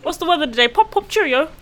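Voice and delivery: high, hammed-up British voice; chirpy parody of British English; mock British accent. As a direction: do a silly British impression through laughter. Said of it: "They'd be like".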